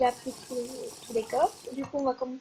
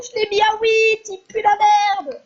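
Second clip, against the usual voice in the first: louder, slower, higher gloating taunt; 7 semitones higher, 11 dB louder than usual. Real, not acted. Gamer taunting the beaten opponent, loud and slow. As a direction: crow it out loud, drawn out.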